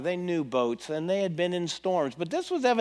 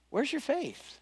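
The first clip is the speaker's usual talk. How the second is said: much softer, gentle question; soft rebuke; 6 semitones higher, 8 dB quieter, slower than usual. Acted. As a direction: ask it quietly, almost disappointed. Jesus quietly questions the disciples.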